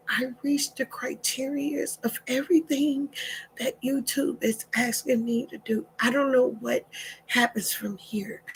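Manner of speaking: soft, halting and emotional; subdued, overwhelmed. Real, not acted. Speaker thanking and blessing viewers after the milestone, soft and overwhelmed.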